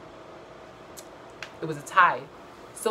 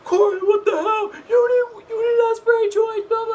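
very high, loud mock yell; mock outraged Paul; 8 dB louder than usual. Mocking Paul yelling at Corey. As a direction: shriek it like a sore loser. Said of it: "and he's like".

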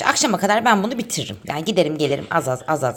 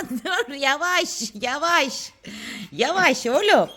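soothing the dog; 8 semitones higher, much slower than usual. Real, not acted. Calming the excited dog.

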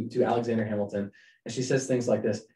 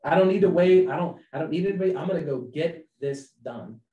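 loud, higher eager self-talk; mock impatient shout; eager acted quote; 6 semitones higher, 7 dB louder than usual. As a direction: blurt it out like a man in a rush.

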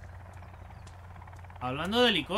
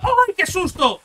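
shrieking much higher and louder; real fright; 13 semitones higher, 12 dB louder, slower than usual. Real, not acted. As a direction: scream from a sudden scare. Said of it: "Me ha asustado".

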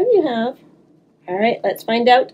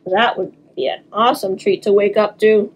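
plain reading voice

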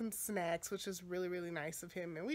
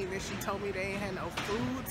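ordinary talk, nothing marked

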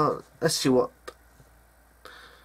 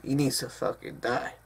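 ordinary talk

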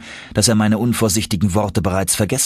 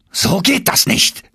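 shouting; 11 semitones higher, 3 dB louder than usual. A narrator's reading. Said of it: "schrie mein Onkel".

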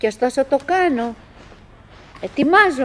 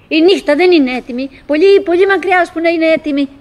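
rising agitation; 5 semitones higher, 6 dB louder than usual. Acted. Building agitation about night.

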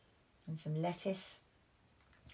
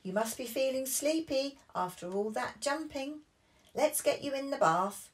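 higher, louder coaxing; lightly voiced cheerful dad; 10 semitones higher, 6 dB louder than usual. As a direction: coax them toward the bath. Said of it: "called Daddy Pig".

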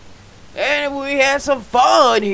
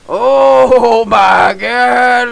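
slow, loud oh my God; excited shout; 6 dB louder, much slower than usual. Real, not acted. Gamer spotting a turkey, excited loud shout. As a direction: belt out oh my God, drawn out.

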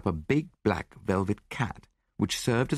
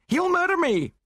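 very high, louder panicked cry; terrified shriek; 5 dB louder than usual. A narrator's reading. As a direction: shriek in fear for your life. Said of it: "she shrieked".

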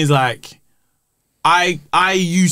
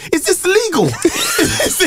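high, laughing disbelief; incredulous; 12 semitones higher, slower than usual. Retold or imitated. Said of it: "I was on the pitch like".

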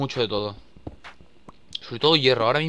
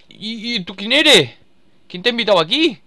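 alarmed shout; 8 semitones higher, 8 dB louder than usual. Real, not acted. Enemy appears suddenly.

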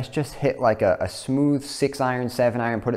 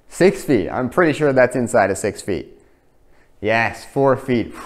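usual speaking voice

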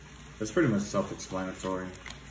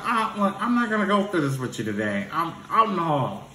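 a hint of a fed-up teacher; 8 semitones higher than usual. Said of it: "He was like".

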